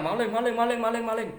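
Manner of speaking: high, loud, rapid-fire alarm cry; crowd shouting thief. Acted. Streamer acts out a bystander yelling thief, mock alarm shout. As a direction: imitate someone raising the alarm. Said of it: "ada tuh orang teriak-teriak".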